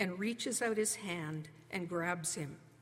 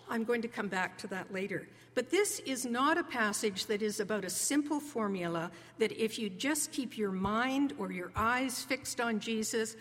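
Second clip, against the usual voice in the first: usual speaking voice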